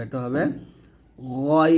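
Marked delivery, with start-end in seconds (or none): 1.4-1.8 গইন said thick from the back of the throat; heavy ghain. Shown as a demonstration. Teacher shows a thick গইন to students. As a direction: make গইন full and heavy. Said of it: "মোটা করে উচ্চারণ করতে হবে".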